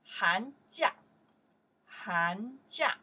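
寒假 called out loud, rising; model pronunciation. Shown as a demonstration. Teacher drilling 寒假 for the class, loud. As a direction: say 寒假 clearly for repetition. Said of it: "we pronounce here".

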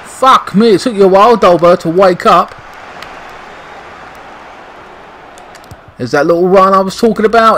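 riding the thrill of the goal; excited. Real, not acted. Gamer praises his striker after scoring, excited.

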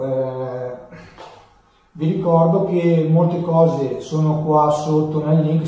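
normal talking voice

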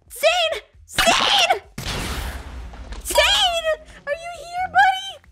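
loud searching call. Acted. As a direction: shout a friend's name across distance. Calling out for missing friend.

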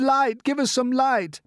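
high, loud plea; crying out. A narrator's reading. Everyone clamouring at the glowworm, high loud cry. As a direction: clamour for it at full voice. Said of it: "they cried".

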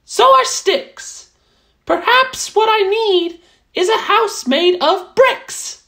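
high, pleased little mouse voice; a small mouse admiring a cozy hut. Acted. Mouse admiring the straw hut, high and content.

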